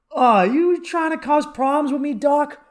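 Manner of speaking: loud, high mock challenge; mock confrontational. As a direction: play at picking a fight, loudly.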